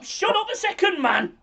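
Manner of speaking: high, sharp shut up; sharp telling off. Real, not acted. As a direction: snap him into silence. Cuts off arguing co-host.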